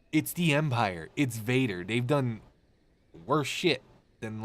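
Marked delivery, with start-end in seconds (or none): none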